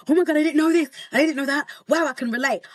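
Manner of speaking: high-pitched mock astonishment; amazed discovery. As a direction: imitate followers reacting in surprise. Voices people finding out about her.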